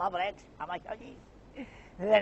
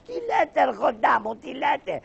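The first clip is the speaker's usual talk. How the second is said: shocked squeal; 7 semitones higher than usual. Acted. Shocked at the threat.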